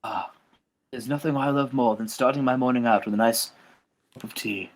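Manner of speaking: lightly voiced English gentleman, low and soft; a hint of genteel English at teatime; Steven Regal. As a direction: savor it in a mild English accent. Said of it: "Steven Regal goes".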